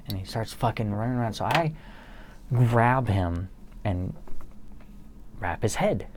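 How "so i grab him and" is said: ordinary talk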